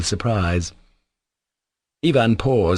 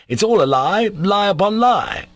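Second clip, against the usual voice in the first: bitter outburst; 12 semitones higher, 3 dB louder than usual. A narrator's reading. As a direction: burst out against the falsehood. Ivan rants bitterly about her.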